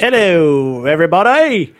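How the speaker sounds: put-on English accent. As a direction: put on a mock English accent that wanders toward Australian. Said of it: "I'd like to do English with it".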